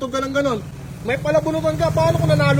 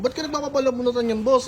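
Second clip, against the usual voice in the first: ordinary talk, nothing marked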